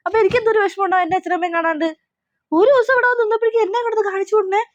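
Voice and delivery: high, indignant scolding that rushes in places; exasperated scolding. Acted. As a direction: berate him shrilly for crying at her home. Wife scolds crying husband. (ഭർത്താവിനോട് ദേഷ്യത്തോടെ ഉയർന്ന ശബ്ദത്തിൽ ശകാരം)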